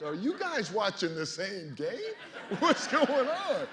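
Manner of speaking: high, laughing protest at the crowd; laughing disbelief. Retold or imitated. Player asks the booing crowd what's going on, laughing. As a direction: laugh through the question, baffled.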